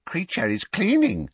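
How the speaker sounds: high, put-on elf voice; house-elf sticking to his story; insistent elf. A narrator's reading. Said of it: "the elf repeated".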